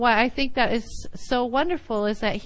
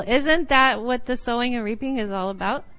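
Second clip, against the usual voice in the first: usual speaking voice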